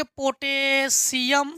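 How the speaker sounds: Potassium drawn out syllable by syllable; slow dictation. Shown as a demonstration. Teacher writing potassium for students, slow dictation with long syllables. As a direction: stretch potassium slowly, as if writing it.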